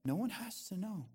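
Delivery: soft, sly whispering tempter; mock whisper. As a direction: coax softly, like sharing a secret. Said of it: "the enemy will whisper to you".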